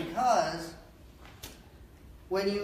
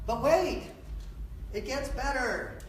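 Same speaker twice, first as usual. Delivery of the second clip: infomercial voice; 7 semitones higher, slower than usual. Shown as a demonstration. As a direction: hype it up like a late-night TV ad. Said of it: "Infomercial voice".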